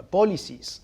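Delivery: ordinary talk, nothing marked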